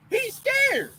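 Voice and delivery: high mocking squeak on scared; mocking taunt. Taunting a coworker about his scared military, high and mocking. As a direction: tease him, voice jumping up. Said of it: "I looked at Jake and I said".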